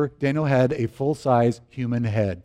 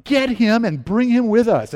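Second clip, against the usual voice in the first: high, slow, raised-voice order; retold yell; 8 semitones higher, 4 dB louder than usual. Nurse ordering a colleague to fetch husband, retold yell. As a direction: imitate a nurse yelling an order. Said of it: "she yells at another nurse".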